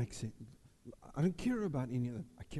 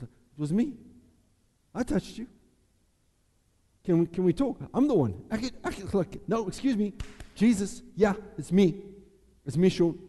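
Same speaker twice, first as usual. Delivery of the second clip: higher and louder, eager; eager confession; 5 semitones higher, 7 dB louder than usual. Retold or imitated. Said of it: "I would have said".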